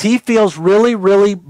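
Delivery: ordinary talk, nothing marked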